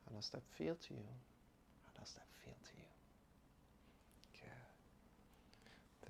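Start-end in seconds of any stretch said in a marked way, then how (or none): 1.8-2.9 breathy whispered repeat, fast. Shown as a demonstration. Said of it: "repeated as whisper". 4.4-6.1 soft whispered instruction. Acted. Whispered guidance.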